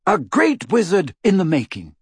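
high, proud proclamation; toasting. A narrator's reading. Lupin toasting his newborn son, proudly proclaimed. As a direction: finish the toast with a flourish. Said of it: "raised them high in a toast".

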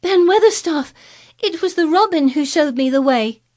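high, loud breathless calling; gasping call. Acted. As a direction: call up, pleading her case. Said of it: "called up to him with a sort of gasp".

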